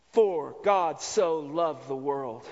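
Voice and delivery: "For God so loved the world" read slowly, low and deliberate, leaning on "so"; slow emphatic recitation. Shown as a demonstration. Preacher slowly quoting John to the congregation, deliberate and low. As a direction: lay out "so" slowly, each word weighted. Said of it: "please understand this word "so".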